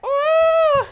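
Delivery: long, high, loud "waaah"; playful spinning wail. Pretend. Teacher twirls back with children, playful dizzy wail. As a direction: let the cry ride the spin.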